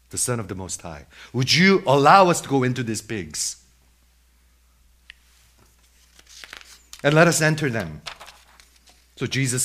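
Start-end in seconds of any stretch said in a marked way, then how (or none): 1.3-8.0 loud acted plea; demons' loud entreaty; begging. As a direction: plead with Jesus at raised volume. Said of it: "they begged, saying".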